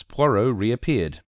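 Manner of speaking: ordinary delivery, nothing marked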